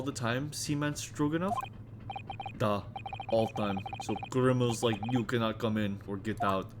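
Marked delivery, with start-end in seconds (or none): 2.6-6.7 Russian crewman. Acted. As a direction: boom it out like a proud Russian sailor. Stroganoff voice.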